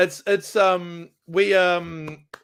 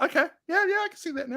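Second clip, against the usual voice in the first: mock agreeing; 13 semitones higher, faster than usual. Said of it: "so I'm like".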